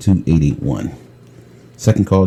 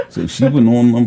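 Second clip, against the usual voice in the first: normal talking voice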